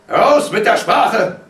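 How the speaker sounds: high, very loud, drawn-out yell; explosive paternal command; father's shout. A narrator's reading. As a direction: shout the demand slowly and forcefully. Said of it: "da schrie der Vater".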